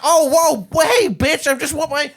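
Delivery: high-pitched comic protest; frantic hawk character. Acted. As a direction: squawk your demand in a very high mock-desperate voice. Hawk demanding dinner from flailing lady.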